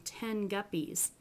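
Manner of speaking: ten guppies with the n pulled back to ng. Shown as a demonstration. Teacher demonstrating velar assimilation, slow. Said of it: "that's the g, you actually go all the way back".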